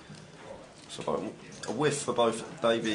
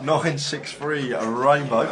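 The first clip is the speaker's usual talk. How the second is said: excited call; 4 semitones higher, 7 dB louder, slower than usual. Real, not acted. Poker board called out.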